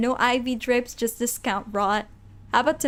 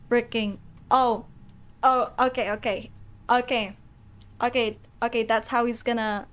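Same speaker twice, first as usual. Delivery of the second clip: ordinary delivery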